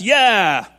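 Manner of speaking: a loud, falling "Yeah!" like a fired-up pew; hammed-up congregation yell; mock amen shout. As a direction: imitate the shouters cheering. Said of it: "the shouters shout amen".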